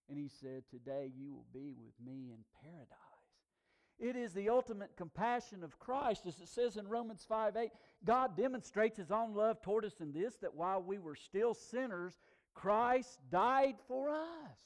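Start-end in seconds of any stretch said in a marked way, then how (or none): 0.7-3.1 hushed, tender whisper. Acted. Jesus to the dying thief, whispered. 12.5-14.6 much higher, louder, very slow; emphatic. Real, not acted. Preacher proclaiming to the congregation, slow and emphatic.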